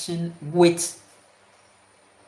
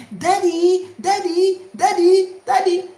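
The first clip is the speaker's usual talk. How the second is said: put-on higher, louder child crying out for daddy; child calling; 10 semitones higher, 6 dB louder, slower than usual. Child calling father as things go wrong, higher and louder. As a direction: call out like a startled kid.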